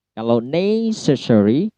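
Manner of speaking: usual speaking voice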